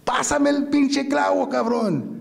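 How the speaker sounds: high, insistent acted demand in Spanish; impatient demand. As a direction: demand it as if fed up with repeating yourself.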